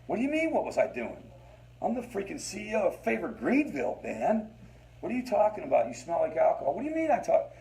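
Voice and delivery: a liar playing the outraged innocent; indignant denial. Husband denying to his wife, in high outrage.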